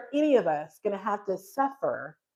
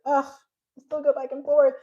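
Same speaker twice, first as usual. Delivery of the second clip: groaned, higher-pitched self-complaint; exasperated; 5 semitones higher, 4 dB louder than usual. Real, not acted. Speaker owning up to her wavering, exasperated. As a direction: lift it in frustration at yourself.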